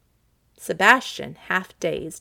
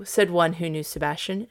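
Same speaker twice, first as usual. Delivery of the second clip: usual speaking voice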